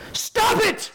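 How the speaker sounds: sudden high, loud comic yell; explosive mock shout as a punchline. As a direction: imitate a shrink yelling across the table. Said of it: "he just yells at him".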